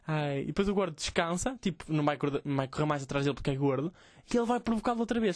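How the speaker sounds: ordinary talk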